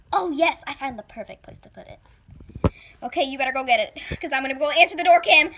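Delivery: ordinary talk, nothing marked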